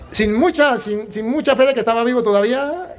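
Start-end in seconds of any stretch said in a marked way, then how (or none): none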